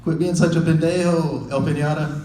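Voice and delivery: a hint of tough gang scolding; gang member scolding. Gang members mocking the comic, mild tough-guy voice. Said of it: "They're like".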